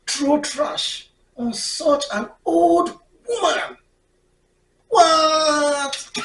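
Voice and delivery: slow scold climbing high. Retold or imitated. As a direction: build from shocked scold to an outraged high what. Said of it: "I was like".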